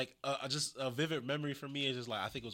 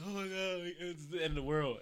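raised, mock-dramatic wail; fake dismay; 7 semitones higher, 4 dB louder than usual. Fan imitating JBL's reaction, fake dramatic dismay. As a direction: overplay the alarm insincerely. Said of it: "fake, like".

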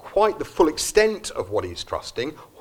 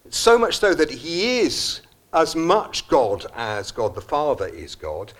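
ordinary delivery

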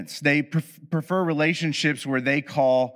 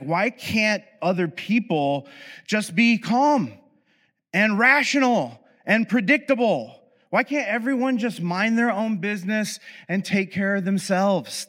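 exasperated; 6 semitones higher than usual. Retold or imitated. Voicing the avoidant mindset.

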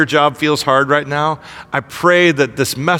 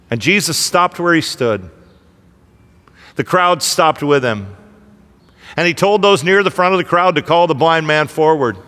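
normal talking voice

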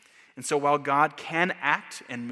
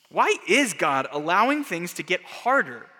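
ordinary talk

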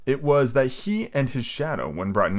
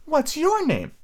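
put-on high girl voice; slower than usual. Wendy asks Peter.

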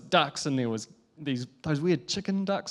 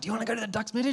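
excited dad voice; 7 semitones higher, 5 dB quieter, much faster than usual. Retold or imitated. Dad asks toddler about ducks.

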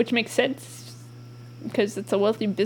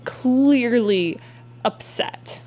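normal talking voice

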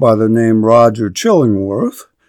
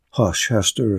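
soft, low hush; 4 semitones lower, 8 dB quieter than usual. Acted. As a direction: hush her gently, much softer. Minister hushes Hester.

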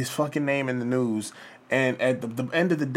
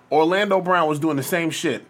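ordinary talk

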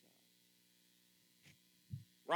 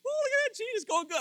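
cheerful pleased voice; 14 semitones higher, 7 dB louder, faster than usual. Retold or imitated. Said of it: "the one that's growing fruit".

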